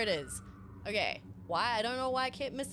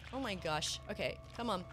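usual speaking voice

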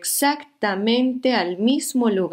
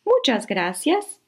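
higher, slower leaf voice; 7 semitones higher than usual. Acted. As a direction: thank them like a small leaf. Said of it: "dijo la hoja".

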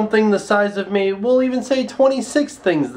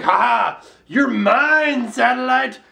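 mock villain gloat; 5 semitones higher, slower than usual. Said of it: "gravity is like".

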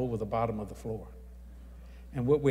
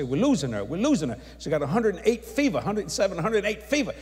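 raised, higher urgent doctor's voice; 5 semitones higher, 4 dB louder than usual. Retold or imitated. Doctor in the hospital room, urgent raised call. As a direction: call it out as the doctor fighting for her.

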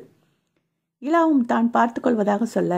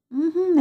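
high whimpering hum of refusal; a sleepy, sulky high-pitched mm-mm; whiny hum; 9 semitones higher, slower than usual. Acted. Feverish wife clinging to husband, whimpering hum. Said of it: "என சினுங்கியவள்".

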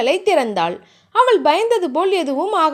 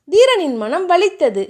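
ordinary delivery

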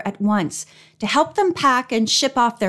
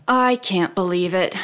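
lower, mock-gloomy lament; mock mournful; 3 semitones lower than usual. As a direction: fake a sorrowful sigh of loss. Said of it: "pretending to be mournful".

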